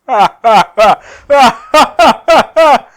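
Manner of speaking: loud, high villain cackle; villain laugh. Acted. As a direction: cackle like a madman. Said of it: "he starts to laugh".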